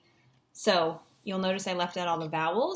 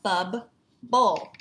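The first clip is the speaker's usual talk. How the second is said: BUB, pause, BOWL, said firmly; louder, separate BUB and BOWL; split into syllables; 6 dB louder than usual. Shown as a demonstration. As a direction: break the word into BUB and BOWL. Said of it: "focusing on those chunks".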